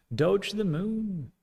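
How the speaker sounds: high, slow mock hype chant; playful long-held cheer; drawn-out rallying cry. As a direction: stretch it out high like a slogan. Drawn-out rallying cry of coin fans.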